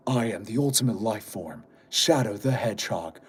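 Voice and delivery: low, quiet brooding hedgehog voice; Shadow voice. Pretend. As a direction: go deep and cool like Shadow. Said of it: "Make Shadow voice".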